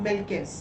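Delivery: "milk is" linked as one word; joined sounds. Shown as a demonstration. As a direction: run the k of "milk" straight into "is". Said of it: "ये आपको ऐसा sound करेगा".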